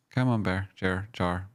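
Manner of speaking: ordinary talk, nothing marked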